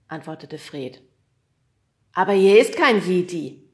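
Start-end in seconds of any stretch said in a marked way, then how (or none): 2.1-3.7 loud, stressing kein; loud retort. A narrator's reading. Kriegelstein calling down to the boys, loud and teasing. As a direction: call down, teasing. Man on roof answers.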